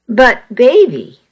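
ordinary delivery

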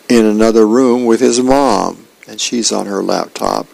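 ordinary delivery

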